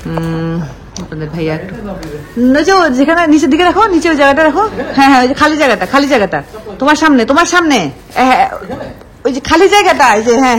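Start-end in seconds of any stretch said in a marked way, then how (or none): 2.5-10.6 calling out. Real, not acted. Directing husband across room.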